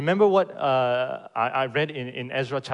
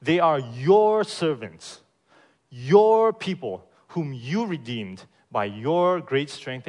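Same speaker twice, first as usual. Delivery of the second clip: every "your" and "you" leaned on, slow and loud at the start; emphasis on your; 6 dB louder than usual. Shown as a demonstration. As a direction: hit each "your" hard and drawn out. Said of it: "read it with a bit of emphasis every time the word you or your comes up".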